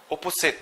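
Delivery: Opposite with a round OH first vowel; wrong pronunciation. Shown as a demonstration. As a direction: say "Opposite" as "oh-po-sit". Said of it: "it's not opposite".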